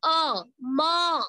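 अ, म split into separate sounds; अ then म said apart for students; sound-by-sound demo. Shown as a demonstration. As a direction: break म into अ and म to show the hidden अ. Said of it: "आप जब उच्चारण करोगे म".

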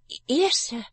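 a touch of a timid stammer; scared girl answering the stranger, stammering. Acted. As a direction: stumble on the first word.

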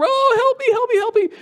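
hammed-up child crying out over a stubbed toe; wailing child. Pastor mimicking a crying grandchild for the church, high mock wail. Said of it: "they come".

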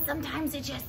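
usual speaking voice

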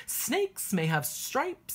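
ordinary delivery

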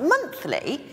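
high, shocked echo of the word; incredulous. Retold or imitated. Hairdresser hearing about monthly pay, incredulous high echo. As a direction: jump up in disbelief at the word.

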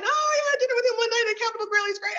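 high, fast, excited gushing; gushing colleague. Retold or imitated. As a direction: gush like an eager name-dropper. Imitating eager interview small talk.

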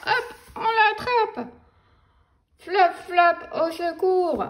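high, excited cry for help; panicked cry. Acted. Grown-up grabbing the flying book, high panicked cry. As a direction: squeal as the book flaps away.

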